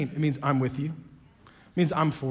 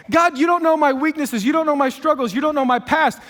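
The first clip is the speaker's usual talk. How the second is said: much higher, louder list of complaints, rushing at the end; loud excuses; 6 semitones higher, 7 dB louder than usual. Retold or imitated. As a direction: fire off every excuse at God. Listing everyone's excuses to God.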